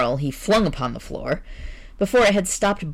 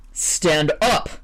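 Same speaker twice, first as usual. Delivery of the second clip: forceful command. Acted. Editor repeats order louder.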